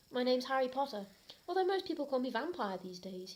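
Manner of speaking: ordinary talk, nothing marked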